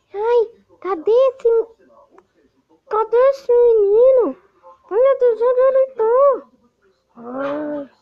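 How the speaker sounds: high, loud hunting monster; villain voice. Acted. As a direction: shriek like a killer searching.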